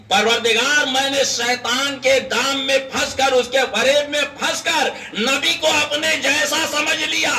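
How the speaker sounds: high, sustained acted plea; urgent high-pitched supplication of a drowning man; desperate prayer. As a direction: raise the pitch and beg for forgiveness. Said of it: "دعا کرنے لگا".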